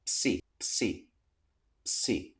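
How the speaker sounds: psi breathed out without voice, P clear before S; voiceless drill of the syllable psi; whispered syllable drill. Shown as a demonstration. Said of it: "ps plus E".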